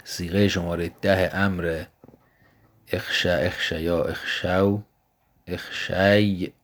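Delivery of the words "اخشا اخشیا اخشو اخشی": اخشی drawn out very slowly with a falling end; slow demonstration. Shown as a demonstration. A teacher shows the original form of the word, slowly. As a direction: stretch every sound of اخشی slowly. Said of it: "اخشی بوده اصلش".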